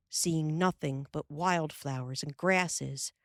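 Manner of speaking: ordinary delivery, nothing marked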